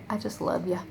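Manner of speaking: normal talking voice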